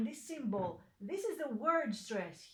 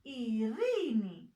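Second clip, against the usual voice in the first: irini slowly, with a long, lifted "ri"; stressed middle syllable; slower than usual. Shown as a demonstration. Teacher demonstrating Greek word stress, irini stressed slowly on the middle syllable. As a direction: stretch the "ri" in irini. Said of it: "the stress goes into the single one".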